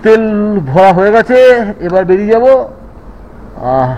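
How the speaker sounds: muffled and loud through a helmet; speaking up from inside a helmet. Real, not acted. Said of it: "helmet পরে আছি".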